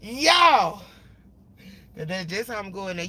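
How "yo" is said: loud, high yelling greeting; mock hype yell. To coworkers on arrival, a loud hype yell. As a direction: burst in hollering. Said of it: "before I go in there and be like".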